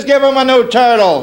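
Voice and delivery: a hint of a mom giving orders; voiced mother. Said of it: "She says".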